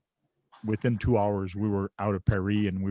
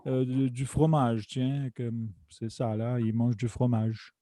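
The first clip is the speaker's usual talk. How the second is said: higher, hammed-up try at a Parisian French accent; failed Parisian French accent; 4 semitones higher than usual. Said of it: "I'm trying to do a Fr-, Fran-, Parisian French accent and it's not working out".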